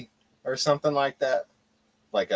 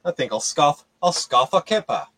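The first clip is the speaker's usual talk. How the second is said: put-on louder, a bit higher posh English accent; lightly put-on posh English accent; 4 semitones higher, 9 dB louder, faster than usual. American mocking posh Brits in posh English accent. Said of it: "posh banter continues".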